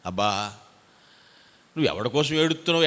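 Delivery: ordinary delivery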